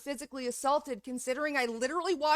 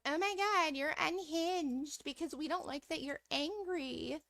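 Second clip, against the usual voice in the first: high, mocking and softer; mocking critics; 4 semitones higher, 6 dB quieter than usual. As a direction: whine it like a shocked critic. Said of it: "a lot of people are like".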